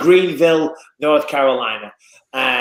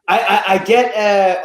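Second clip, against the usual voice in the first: ordinary delivery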